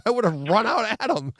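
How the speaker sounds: telling his co-host he'd have charged the vandals, worked up; high excited retelling. Retold or imitated. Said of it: "I go".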